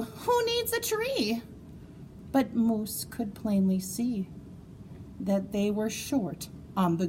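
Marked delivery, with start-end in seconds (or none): 0.0-1.4 put-on high, brave-faced kid; kids' voice. Kids cheering up Moose, high kid voice. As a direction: shrug it off like a child. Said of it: "They said".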